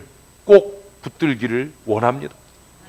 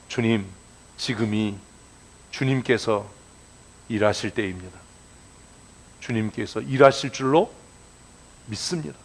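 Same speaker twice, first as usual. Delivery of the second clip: soft, lower, calm prayer; gently, gracefully; 6 semitones lower, 8 dB quieter than usual. Shown as a demonstration. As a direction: pray it quietly and with dignity. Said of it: "정말 점잖게, 우아하게". (점잖고 우아하게 조용히)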